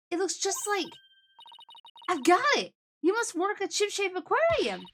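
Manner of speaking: high-pitched, eager deduction; excited realization. Acted. Colleague working out where the client works, high excited realization.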